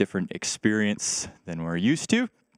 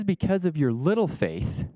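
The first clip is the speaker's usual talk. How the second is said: slow, higher, dry mocking lean on "little"; a little sarcastic; 7 semitones higher than usual. Shown as a demonstration. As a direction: tease them lightly, stretching "little". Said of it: "Jesus gets a little bit sarcastic".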